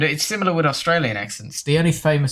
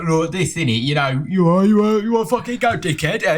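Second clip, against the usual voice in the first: slurred mock British yob accent, pitched up; rough British accent; 5 semitones higher, 3 dB louder than usual. Showing friends how Brits really talk, rough mock British accent. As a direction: put on a garbled, rowdy British accent. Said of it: "British people talk like".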